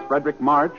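ordinary delivery

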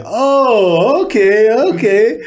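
high impressed women voices; impressed women's voices. Retold or imitated. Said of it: "They're like".

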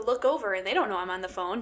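ordinary delivery

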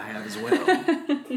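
laughing. Real, not acted. Laughing after putting on voice.

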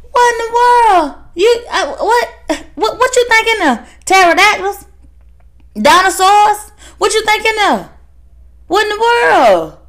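hammed-up outraged astonishment; incredulous. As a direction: squeal what in the world as if it's absurd. Exasperated at relatives' fears.

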